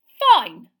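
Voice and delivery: loud clipped shout; angry. A narrator's reading. Girl storming out of the class, shouting. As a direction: spit it out furiously.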